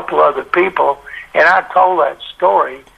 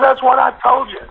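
told you so; 9 semitones higher than usual. Retold or imitated. Narrator replies to Wizig.

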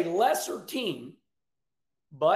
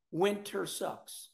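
fading softer; 7 dB quieter, slower than usual. Shown as a demonstration. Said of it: "repeated for emphasis".

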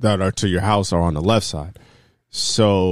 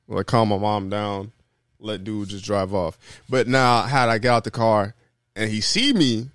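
usual speaking voice

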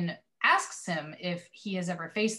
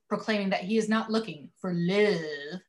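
ordinary delivery

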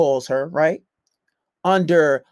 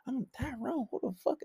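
very soft mock double take; startled undertone; 5 semitones higher, 15 dB quieter than usual. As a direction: question it under your breath, acted. Acts out hidden reaction to Tyrone.

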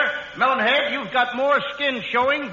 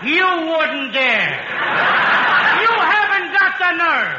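comic panic; 6 semitones higher, 4 dB louder, slower than usual. Acted. Costello panics at surgery threat.

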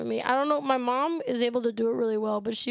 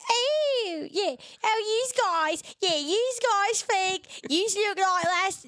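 put-on high, stretched-out South Philly lady accent; hammed-up South Philly lady, higher and slower; 8 semitones higher, slower than usual. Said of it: "She's a South Philly lady".

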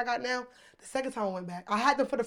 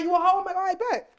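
mocking retort; 9 semitones higher, 6 dB louder than usual.

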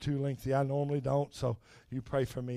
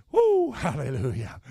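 joyful exclaim; 2 semitones higher, 8 dB louder than usual. Real, not acted. Preacher exclaims in worship.

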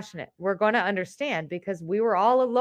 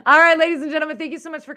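announcer; 6 semitones higher, 7 dB louder, faster than usual. Acted. Announcer sign-off opening.

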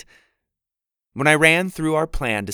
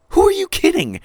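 much higher, louder disbelieving outburst; you don't buy his lie for a second; incredulous; 9 dB louder than usual. Acted. Winnie scoffing at Jack's slide story, loud disbelief.

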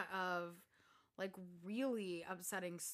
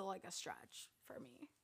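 whisper; 11 dB quieter, slower than usual. Real, not acted. Aside about the plot.

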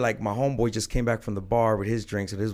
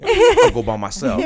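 laughs through it, high and loud; mock tough talk broken by a laugh; 8 dB louder than usual. Mocking his younger self's ego, cracking up.

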